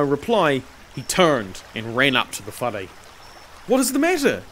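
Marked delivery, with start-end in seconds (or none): 3.7-4.4 high, startled question; exclaiming. A narrator's reading. Master sees the slave's face, exclaims. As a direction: exclaim it on seeing something wrong. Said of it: "Tutanikai exclaimed as he saw".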